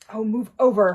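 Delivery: loud, impatient snarl. A narrator's reading. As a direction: snap it out in frustration. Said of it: "Hermione snarled".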